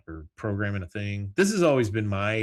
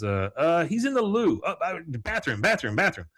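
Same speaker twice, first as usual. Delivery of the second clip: flustered call center guy; 8 semitones higher, 4 dB louder than usual. Retold or imitated. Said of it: "He goes".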